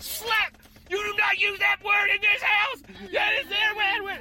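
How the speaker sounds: high-pitched mock-furious scolding; outraged mother laying down the law; scolding mama. Acted mama scolding her child.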